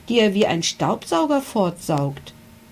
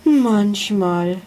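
sighing sadly; 3 semitones higher, 4 dB louder than usual. A narrator's reading. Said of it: "seufzte er traurig".

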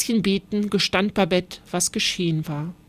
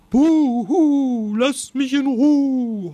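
long, drawn-out hooting wail, higher and louder; starling's owl-like howl; 5 semitones higher, 4 dB louder, much slower than usual. A narrator's reading. Starling mimicking the eagle owl by Babette's window, howling. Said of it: "heulte der Star".

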